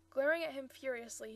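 usual speaking voice

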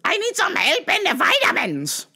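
high-pitched mocking customer voice; demanding customer. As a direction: screech it like an entitled shopper. Impersonates the demanding hyena-like customer.

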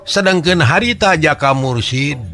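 ordinary delivery

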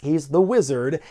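ordinary talk, nothing marked

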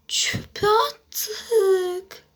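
whisper. Real, not acted. Hiding from brother, whispering.

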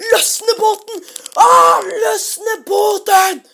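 loud, laughing panic shout, dragged out; stretched, frantic shout to cast off; frantic laughing yell. Retold or imitated. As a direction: yell it half laughing, half panicking to untie the boat. Panicked kids yelling to untie boat.

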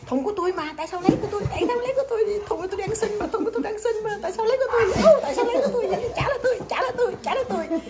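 high-pitched playful pet voice; Thay Đồ. Pretend. Pet demanding its box back, high playful complaint. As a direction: protest in a high voice, laughter creeping in. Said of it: "thay đồ nói vậy đó".

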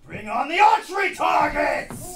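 loud slow villain command; commanding shout. Acted. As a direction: bark the order to the guards.

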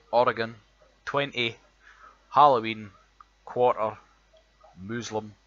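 reads each word out slowly and loudly in a broad Edinburgh accent; Edinburgh accent word list. Shown as a demonstration. Reading a word list to show his Edinburgh accent, loud and deliberate. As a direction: lays every word out clearly, showing off the Edinburgh sound. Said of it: "the words that we've got".

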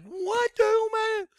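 high-pitched what the hell, man; freaked-out outburst. Retold or imitated. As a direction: squeak it out in disbelief. Freaking out at the discovery.

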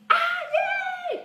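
very high, loud squealed cheer. Shown as a demonstration. Teacher pretending to win a phone game, cheering. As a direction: shout it like winning.

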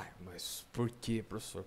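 put-on student voice, hushed and low; questioning student. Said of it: "Você fala".

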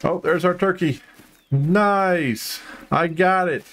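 high, drawn-out delight; pleased, high-pitched hunter's triumph; delighted discovery. Real, not acted. Finds the turkey he shot.

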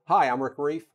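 ordinary talk

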